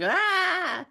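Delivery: high, loud cartoon scream; skeleton scream. A zapped skeleton in the comic, with a high cartoon scream. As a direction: shriek as if electrocuted. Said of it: "a skeleton going".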